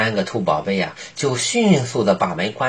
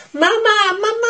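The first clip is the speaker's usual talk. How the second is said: put-on very high, joyful child call; happy calling. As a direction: bunnies calling happily for mother. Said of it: "开心地叫道".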